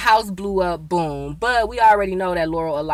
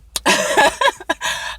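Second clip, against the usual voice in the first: breathy laugh; 11 semitones higher, 4 dB louder than usual. Real, not acted. Laughs at own fantasy.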